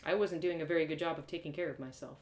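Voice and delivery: usual speaking voice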